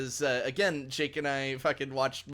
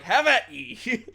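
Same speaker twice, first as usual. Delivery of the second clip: slow, high, loud warrior's challenge; 7 semitones higher, 8 dB louder, slower than usual. Retold or imitated. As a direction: bellow it like an old knight. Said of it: "Battle Beast in the background's like".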